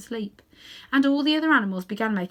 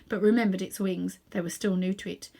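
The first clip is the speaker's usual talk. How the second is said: ordinary delivery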